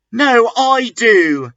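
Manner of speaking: high hammed-up retort; mock shout. Vampire shouts back at Draco, high and hammed.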